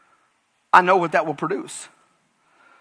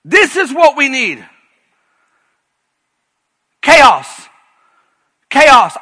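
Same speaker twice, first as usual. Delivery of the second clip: loud, high, shouted pulpit fervor; full-force preaching; 10 semitones higher, 10 dB louder than usual. Acted. Pastor shouting warnings about God's thresholds at full force. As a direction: preach it like a revival, near crying out.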